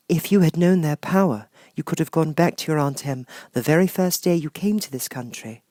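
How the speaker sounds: high, gentle sorceress voice; kind witch Glinda. Acted. Glinda promising Dorothy a way home, high and gentle. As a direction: soothe the child like a kind fairy.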